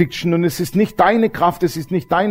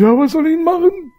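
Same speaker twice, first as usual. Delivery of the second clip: very high-pitched helpless protest; hammed-up, high-pitched exasperated wail; panicked squawk; 13 semitones higher than usual. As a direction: squeal it back in mock panic. Panicked reply to Jesus, retold.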